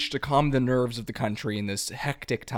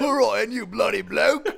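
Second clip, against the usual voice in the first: mock British shout; 9 semitones higher than usual. Cohost repeats the protester's line, laughing British shout. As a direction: shout it like a cartoon London heckler. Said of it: "he's shouting that".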